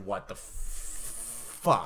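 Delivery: hushed, drawn-out mock disbelief; stunned disbelief. Stunned viewer recalling his first watch, slow hushed disbelief. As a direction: whisper it very slowly, as if dumbstruck.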